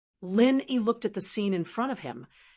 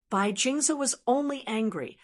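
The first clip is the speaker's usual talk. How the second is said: ordinary delivery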